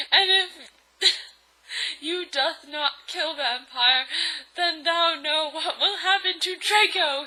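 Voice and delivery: high, loud mock-villain threat; Voldemort shouting. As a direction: shout it with hammy archaic menace. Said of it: "he shouted".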